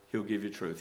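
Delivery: ordinary talk, nothing marked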